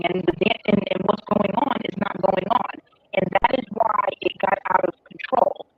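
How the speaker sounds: choppy, underwater-sounding Bluetooth audio; muffled, breaking-up call line; glitching audio. Real, not acted. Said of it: "your audio is, is choppy".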